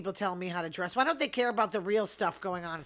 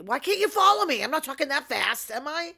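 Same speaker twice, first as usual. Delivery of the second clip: high, exasperated comic complaint; mock-indignant protest; 6 semitones higher, 5 dB louder than usual. Fast talker to baffled store customers, mock indignant. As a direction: protest in a raised, pleading pitch. Protests that listeners can't follow her.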